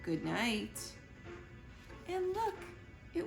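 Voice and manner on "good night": plain reading voice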